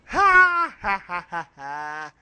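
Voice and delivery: loud, slow, spelled-out fake ha ha ha; hammed-up mocking laugh, each ha punched loud; sarcastic laugh. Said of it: "that was a freaking sarcasm laugh".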